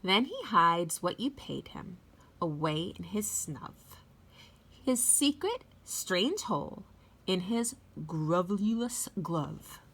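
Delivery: lively read-aloud with big swoops in pitch; storybook reading. A narrator's reading. Teacher reading The Lorax to children, expressive read-aloud voice. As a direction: read it like story time for little kids.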